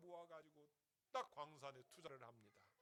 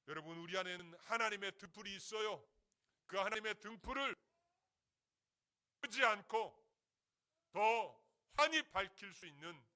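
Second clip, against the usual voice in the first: loud, raised exhortation; preaching at full force; 6 semitones higher, 18 dB louder than usual. Real, not acted. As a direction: drive the appeal home to the congregation. Preaching climax of the sermon.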